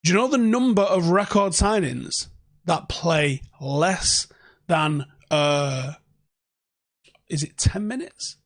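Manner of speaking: normal talking voice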